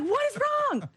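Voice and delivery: high startled question; alarmed partner. Retold or imitated. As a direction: jump in alarm at the gasp. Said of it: "she's like".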